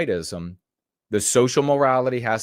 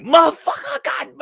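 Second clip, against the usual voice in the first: much higher, loud yelp of a curse; startled outburst; 6 dB louder than usual. Retold or imitated. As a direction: blurt it like a bee just flew in your mouth. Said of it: "And then he's like".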